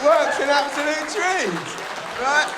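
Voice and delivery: loud, high and fast; proud shout; triumphant. Real, not acted. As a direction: sell it like a genius trick. Triumphant reveal of technique.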